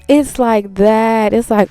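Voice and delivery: ordinary delivery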